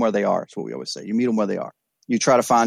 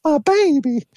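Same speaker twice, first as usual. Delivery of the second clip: imitating his crying mother, pitched far higher; mom crying; 4 dB louder, slower than usual. Son confesses to his mother, she answers in a high crying voice. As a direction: cry it out in mom's much higher voice. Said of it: "And I'll never forget my mom crying".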